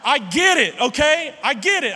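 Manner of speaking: emphatic admission. Real, not acted. Laughing admission to congregation.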